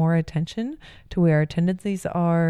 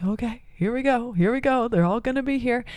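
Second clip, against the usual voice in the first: bracing herself; 7 semitones higher than usual. Retold or imitated.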